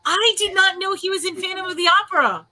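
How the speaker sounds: high, loud amazement; floored by the discovery, loud and high; astonished exclamation. Real, not acted. Astonished by news about the actor.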